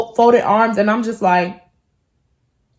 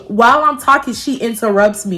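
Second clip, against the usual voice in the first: usual speaking voice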